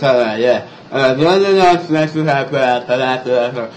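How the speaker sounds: slurred mock garbled speech; numb-throat talk. Patient after throat-numbing medicine, slurred and garbled. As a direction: imitate talking with a numbed mouth, slurred. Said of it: "And I'll talk like".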